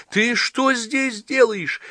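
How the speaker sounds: high, slow delighted cry; joyful surprise. A narrator's reading. John rushing to the girl he is glad to see, high. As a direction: rush to her overjoyed. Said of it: "бросился к ней Джон".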